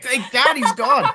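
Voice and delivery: high, loud laughing jab; a loud, high, laughing dig at the host; laughing tease. Real, not acted. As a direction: laugh it out, teasing him.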